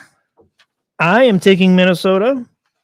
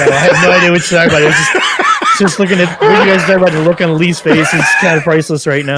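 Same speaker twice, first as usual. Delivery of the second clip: laughing. Real, not acted. Laughing at Lee's face.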